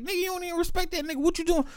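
fast and high, half-joking; quick high-pitched mock scold; shocked reproach. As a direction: rattle it off high like scolding a friend. Retold own reaction to disrespect.

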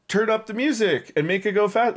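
hammed-up high excitement; mock party crowd. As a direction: imitate an eager crowd calling out. Voicing party crowd wanting louder music.

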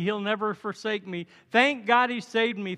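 usual speaking voice

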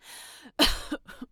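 a harsh, breathy heaving cough; retching cough. Acted. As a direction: cough as if about to vomit. Retching cough after feeling sick.

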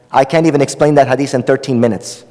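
usual speaking voice

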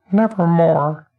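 high bird voice; mumbling bird. Acted. Bird mocking the dead man, high bird voice. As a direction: mutter it like a raven under its wing.

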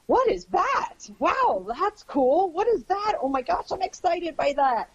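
a dog discovering a new sound, high and awed; curious dog's voice. Retold or imitated. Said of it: "This is your dog going".

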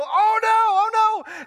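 high-pitched mock panic; imitated alarm. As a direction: cry out like a startled wife, playfully. Acting wife's alarm on the phone.

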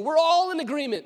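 loud, high declaration; emphatic proclamation. Acted. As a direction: declare it for the whole council. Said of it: "When he's saying".

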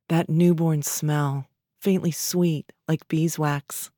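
ordinary delivery, nothing marked

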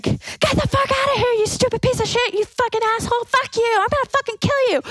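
very high fast screamed threats; mock screaming. Friend screaming at the homeless guy in mock fury. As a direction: shriek it, chasing him off.

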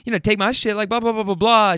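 high, fast sucking-up mimic; squeaky fake-nice imitation of her; mimicking the passenger sucking up; mocking her voice. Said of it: "She's like".

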